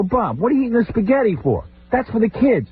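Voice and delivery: high, indignant scolding; scolding father-in-law, high mock outrage. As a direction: yelp it at the spaghetti thief. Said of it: "I go".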